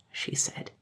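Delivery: ordinary delivery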